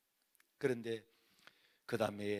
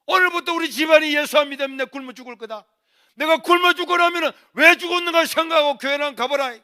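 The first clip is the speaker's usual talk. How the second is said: desperate ultimatum; 13 semitones higher, 22 dB louder than usual. Retold or imitated. Son threatens hunger strike.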